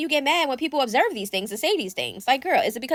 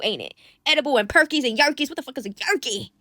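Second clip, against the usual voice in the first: usual speaking voice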